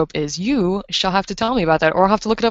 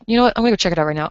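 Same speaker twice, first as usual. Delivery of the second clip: very fast hurried words; rushed; much faster than usual. Real, not acted. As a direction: blurt it out fast. Rushed aside.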